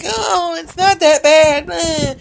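high, slow, mocking imitation of mom; mimicking a dismissive mother in a high voice. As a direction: sneer her dismissive words back in a high voice. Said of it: "she fucking starts it on my ass".

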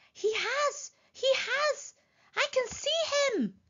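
high, drawn-out exclamations; excited elephant. A narrator's reading. Elephant thinks it has spotted Wilbur, excited. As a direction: squeal it high and eager.

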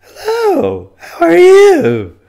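very high, loud, strained greeting that breaks up; voice giving out. Shown as a demonstration. Sick worker demonstrating the greeting that won't come out, voice giving out. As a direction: push a high work greeting through a lost voice. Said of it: "So when I wanna go".

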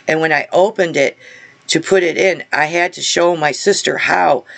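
ordinary talk, nothing marked

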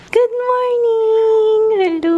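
high, loud, drawn-out good morning; sing-song greeting. Real, not acted. Vlogger greeting someone at home, high sing-song call. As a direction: call out a long, high-pitched morning hello.

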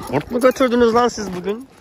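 high, loud playful scold; götürdünüz with a rising tease; mock scolding. Real, not acted. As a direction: tease the horses like a fussy aunt. Teasing the horses.

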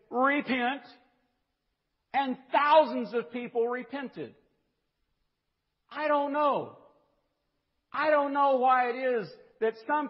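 loud, high, driving sermon climax; fiery preacher pushing to a shouted peak; preaching at full force. Acted. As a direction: pound it home to the congregation.

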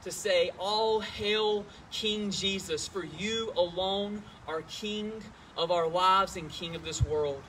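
praying reverently to open the service; opening prayer. Real, not acted.

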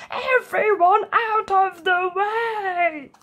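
high, slow, drawn-out frog shout; a frog shouting a warning as all slide down. A narrator's reading. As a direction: call everyone clear, stretched out.

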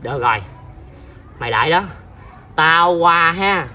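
low, controlled threat; menacing resolve. Acted. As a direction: drop your voice and promise payback. Uncle sets off to take revenge.

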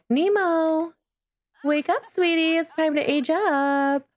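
high, sweet wake-up call; tender coaxing. Real, not acted. Waking a Sim baby.

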